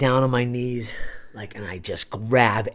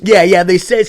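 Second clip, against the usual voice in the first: ordinary talk, nothing marked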